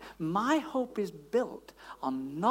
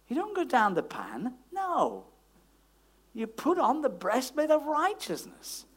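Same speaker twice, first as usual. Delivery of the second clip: normal talking voice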